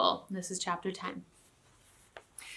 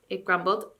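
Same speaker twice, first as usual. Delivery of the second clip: ordinary delivery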